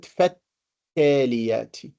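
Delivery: "فالتاليات" as fat-taliyat, doubled T and no L; shadda, silent lam. Shown as a demonstration. Teacher contrasting two words, "فالتاليات" with lam silent. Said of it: "we pronounce it".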